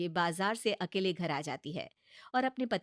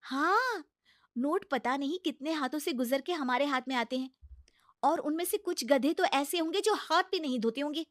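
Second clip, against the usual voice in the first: higher, coaxing bahu voice; pleading daughter-in-law; 5 semitones higher, 5 dB louder than usual. Acted. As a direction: talk sense into a stubborn mother-in-law. Bahu argues about cash. (ऊँची, मनाती हुई बहू की आवाज़)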